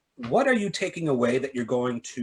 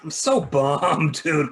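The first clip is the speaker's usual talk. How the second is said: ordinary talk, nothing marked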